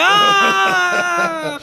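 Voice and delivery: long, high, loud shriek; sudden sustained scream of pretend terror; mock scared scream. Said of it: "I'd have been scared".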